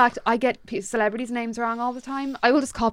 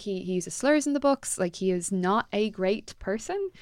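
usual speaking voice

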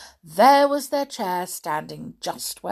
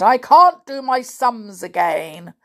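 loud complaint about failing sums; frustrated, forceful storybook giant; exasperated giant; 8 dB louder than usual. A narrator's reading. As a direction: grumble at full voice.

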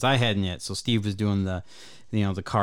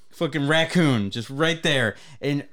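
ordinary talk

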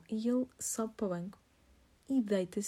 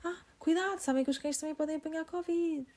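mild mock yell; 8 semitones higher than usual. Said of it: "grita e diz".

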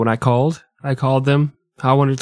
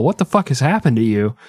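ordinary talk, nothing marked

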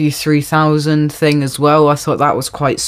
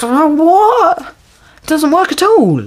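incredulous; 12 semitones higher than usual. Real, not acted. Disbelief at film's logic.

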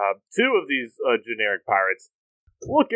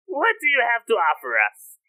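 parrot squawk; 12 semitones higher than usual. Acted. Parrot demanding an offer from the players, squawking. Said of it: "the parrot squawks out".

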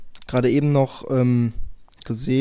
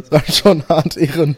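louder laughing repeat; 6 dB louder than usual. Real, not acted.

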